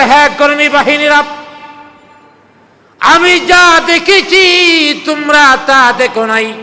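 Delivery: loud, high exclaiming; emphatic retort. Retold or imitated. Abu Jahl answering his puzzled followers, loud emphatic retort.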